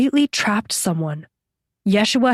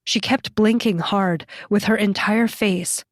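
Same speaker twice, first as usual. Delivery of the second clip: usual speaking voice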